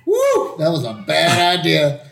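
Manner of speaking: ordinary delivery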